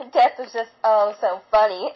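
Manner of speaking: plain reading voice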